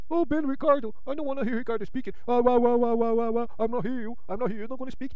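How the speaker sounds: high whiny mock crying; mocking crybaby. Mocking a rival as a crying child, high whine. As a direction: whine like a bawling kid begging. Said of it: "like a child, crying".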